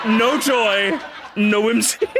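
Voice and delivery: cracking up mid-catchphrase; laughing. Shown as a demonstration. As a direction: say it again through laughter. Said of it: "I wanna say it, gamers".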